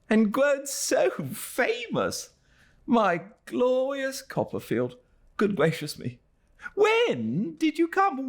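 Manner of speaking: put-on high, overjoyed old friend; excited Traddles. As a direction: gush with delight at a reunion. Excited questions.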